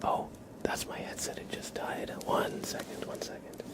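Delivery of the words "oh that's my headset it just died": ordinary talk, nothing marked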